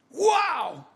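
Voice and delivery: high, drawn-out wow of relief; put-on awestruck exclamation; amazed. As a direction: act out a debtor's joyful astonishment. Forgiven debtor's amazed reaction.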